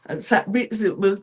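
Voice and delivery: ordinary talk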